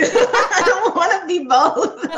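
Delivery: high, slow words through laughter; laughing. Real, not acted. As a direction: laugh while you say it. Laughing at her own answer.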